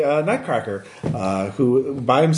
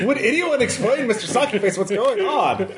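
bewildered high puppet voice; 6 semitones higher than usual. Acted.

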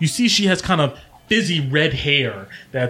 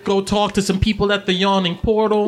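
high-pitched friend reporting gossip; Sly, teasing; 8 semitones higher than usual. Acted. Sly showing Domino the broadsheet, teasing. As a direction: read it out high, needling him.

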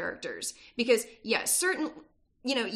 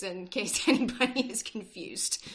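normal talking voice

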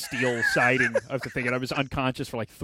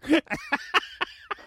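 high burst of laughter; unrestrained real laughing fit. Real, not acted. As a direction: laugh freely at his expense. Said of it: "Go ahead, laugh".

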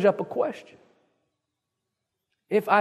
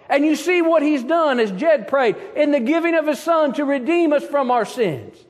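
loud, higher preaching, driving on; preaching at full force; 5 semitones higher, 4 dB louder than usual. Acted. Pastor exhorting his congregation, full-force preaching. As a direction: preach it out to the pews.

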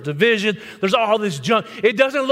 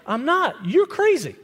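ordinary talk

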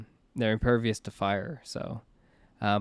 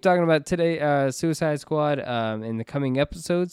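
podcast sign-off; 4 semitones higher, faster than usual. Acted. Host plugs the show to listeners, brisk sign-off.

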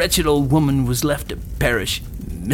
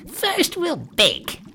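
high, slow witch voice; old dame; 14 semitones higher, much slower than usual. A narrator's reading. Witch announces the baking to Gretel, crone voice. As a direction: draw it out as a high-pitched crone. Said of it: "said the old dame".

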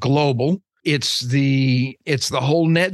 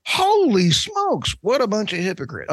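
worked-up outburst; 7 semitones higher than usual. Real, not acted. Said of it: "I'm done worked up on this".

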